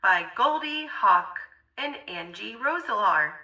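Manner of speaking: ordinary delivery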